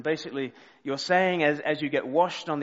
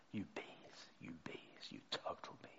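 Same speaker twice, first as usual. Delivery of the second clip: unvoiced breathy whisper of a swear; whispered under breath; 8 semitones lower, 21 dB quieter than usual. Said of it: "under my breath".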